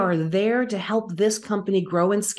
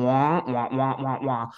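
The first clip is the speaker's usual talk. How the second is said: lower, droning wah wah like a muted trombone; muffled wah wah; 6 semitones lower than usual. Retold or imitated. Recalling the CEO's talk to the host as a low mocking wah wah. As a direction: make the boss's talk sound like meaningless noise. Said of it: "And I hear".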